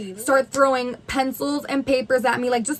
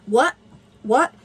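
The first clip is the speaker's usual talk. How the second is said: short taunting questions; egging them on with mocking whats; mocking imitation; slower than usual. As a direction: jeer at someone's way of talking. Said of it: "mocking the way that I'm talking".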